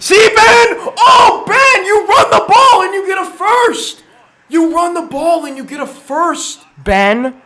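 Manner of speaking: high, full-volume yell at the coach; exasperated shouting. Real, not acted. Fan yelling at coach Ben about play calls, exasperated shouting.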